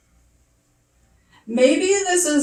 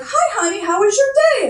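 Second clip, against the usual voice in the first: high sing-song greeting; mock sweet welcome home; 10 semitones higher, 4 dB louder, faster than usual. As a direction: chirp it like a doting wife. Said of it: "instead of like".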